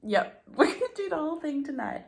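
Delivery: overeager self-pep talk. Retold or imitated. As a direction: hype yourself up with a laugh. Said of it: "I'm like".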